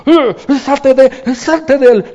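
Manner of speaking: high, loud exorcising command; commanding shout. Acted. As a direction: cast the demon out with force. Jesús expulsa al demonio. (orden gritada, aguda y fuerte)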